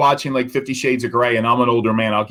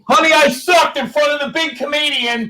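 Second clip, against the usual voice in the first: mock whine; 13 semitones higher than usual. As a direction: whine it up high like a sulking kid. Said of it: "mocks his own failed joke".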